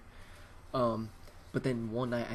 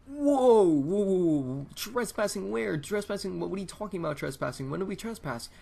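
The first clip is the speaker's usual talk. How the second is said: acted-out shock; 8 semitones higher, 6 dB louder than usual. Kid hearing about a trespassing charge, hammed-up shock. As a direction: play up disbelief, voice shooting up high.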